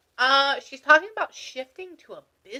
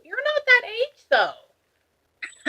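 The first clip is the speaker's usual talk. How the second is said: high, slow, loud protest; astonished disbelief; 6 dB louder, much slower than usual. Real, not acted. Medium amazed the client has a grown child, astonished disbelief.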